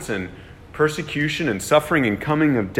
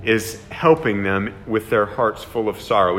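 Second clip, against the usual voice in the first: normal talking voice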